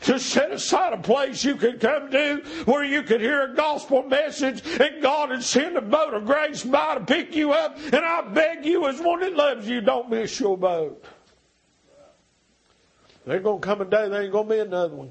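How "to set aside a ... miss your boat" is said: full-force preaching. Acted. Preacher begging the congregation not to miss the boat, full-force preaching. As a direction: preach it out, begging them to come.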